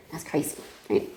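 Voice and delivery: hushed. Retold or imitated. Stunned after detectives leave.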